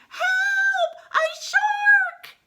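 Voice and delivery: very high, loud drawn-out shriek; screaming. A narrator's reading. Dogs flee from the shark, high terrified scream. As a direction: shriek in cartoon terror. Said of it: "And they scream".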